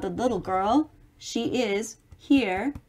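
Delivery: ordinary delivery, nothing marked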